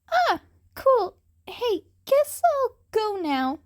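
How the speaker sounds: put-on high, loud, cheeky young voice; cocky cat boy. A narrator's reading. Cat boy teases Eraserhead, high and cheeky.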